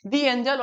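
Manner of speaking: दी with a long ee leading into angel; "thee" before a vowel. Shown as a demonstration. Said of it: "क्या बोलेंगे?".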